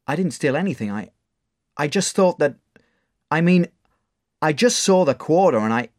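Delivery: put-on scared boy. Caught boy denying the theft: frightened stammer. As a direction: stumble over excuses.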